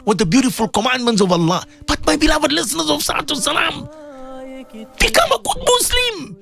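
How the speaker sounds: high exhortation, climbing to a peak; impassioned preaching. Acted. Urging listeners to become good Muslims, high impassioned preaching.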